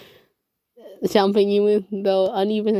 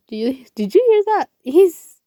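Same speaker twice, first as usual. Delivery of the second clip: excited; 9 semitones higher, 6 dB louder than usual. A narrator's reading. Excited gossip outside the door.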